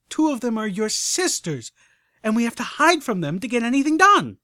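from firm to loud, high outrage; rising indignant rant; building angry rant. Acted. Scootaloo rants, escalating.

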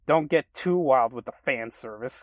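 loud teasing call; playful exclamation. Acted. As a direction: tease them with a wink. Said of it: "Kevin exclaimed with a wink".

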